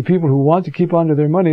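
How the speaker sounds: ordinary talk, nothing marked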